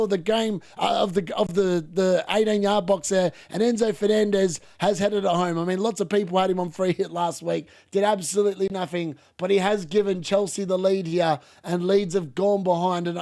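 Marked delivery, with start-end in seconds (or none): none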